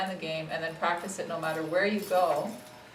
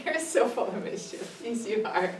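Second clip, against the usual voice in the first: higher, fond teasing voice; playful pet talk; 4 semitones higher than usual. Real, not acted. Trainer to a mischievous puppy after laughing, playful. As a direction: tease the puppy affectionately, pitched up.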